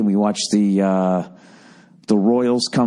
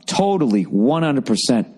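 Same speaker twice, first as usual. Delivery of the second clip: ordinary talk, nothing marked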